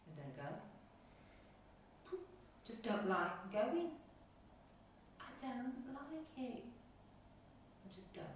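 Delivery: halting and subdued, with long pauses; slow, hesitant admission, mostly softly spoken; reluctant. Acted. Woman admitting she avoids going, reluctant.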